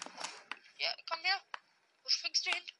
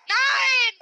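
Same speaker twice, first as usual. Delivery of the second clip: shout; 9 semitones higher, 8 dB louder than usual. Real, not acted. Team dying in fight.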